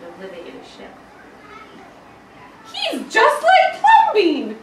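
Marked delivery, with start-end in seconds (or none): 2.7-4.6 high, loud, slow exclaim with a laugh; laughing loud exclamation. Acted. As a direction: cry it out amazed and amused. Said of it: "the people said".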